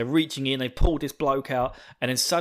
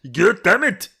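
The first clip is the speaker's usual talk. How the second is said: higher, loud, slow barking action-hero yell; Arnie-style commander; 8 semitones higher, 8 dB louder than usual. Retold or imitated. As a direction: bark it like Schwarzenegger at recruits. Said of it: "I wish they got Arnie to do his voice".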